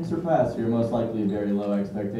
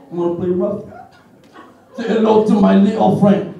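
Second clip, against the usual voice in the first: louder, higher Tony Montana bark; a lightly voiced Tony Montana; Scarface impression; 6 semitones higher, 5 dB louder than usual. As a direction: shout it like Scarface with a gun. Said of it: "do some impressions".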